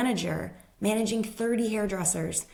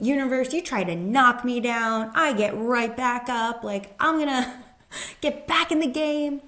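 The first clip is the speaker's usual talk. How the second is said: mock-heroic vow, laughing at the end; defiant vow; 5 semitones higher, 3 dB louder than usual. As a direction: tell the universe you'll get back up. Defiant speech to the universe.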